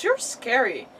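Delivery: ordinary talk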